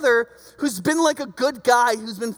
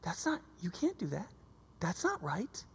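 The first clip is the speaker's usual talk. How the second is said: lower, much softer voice of God; a hushed, lowered divine rebuke; 4 semitones lower, 14 dB quieter than usual. Acted. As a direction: speak as God rebuking through the prophets, hushed. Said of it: "God spoke through his prophets and said".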